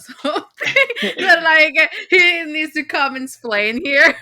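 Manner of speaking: cracking up mid-sentence, high and loud; real laughing. Real, not acted. Guest telling her reaction to the comment section, laughing hard. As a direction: laugh loudly through the words, pitch climbing.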